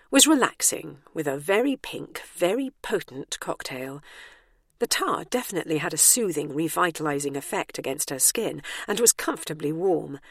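plain reading voice

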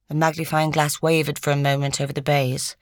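ordinary delivery

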